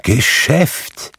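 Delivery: indignant echo with a rising end; exclaimed ghostly question; crying out. A narrator's reading. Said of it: "rief das Gespenst".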